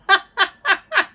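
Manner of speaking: breaks into high giggling; can't hold back a laugh; burst of laughter. Real, not acted. Reader cracking up at the fanfic, giggling.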